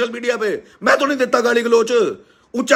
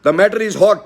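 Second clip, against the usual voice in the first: put-on American accent; 3 semitones lower, much slower than usual. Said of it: "हार्ट कहते हैं American, American accent में".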